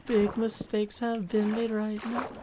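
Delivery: slow, lightly put-on cartoonish old-timer voice; Yogi Berra voice. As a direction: drag it out in a mild character voice. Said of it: "That's an impersonation of famous".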